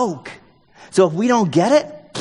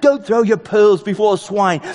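loud, slow, raised British accent in mockery; mocking British accent; 4 semitones higher, 5 dB louder than usual. Preacher voicing Jesus mocking the religious elite, mocking British accent. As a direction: draw it out loudly in a sarcastic British voice. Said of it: "you wanna do it in a British accent".

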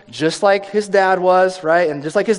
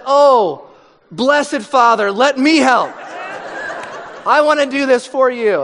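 mock angelic child; 5 semitones higher than usual. Ten-year-old answering the parent, hammed-up eager child. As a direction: ham up the perfectly obedient kid. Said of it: "your ten-year-old says".

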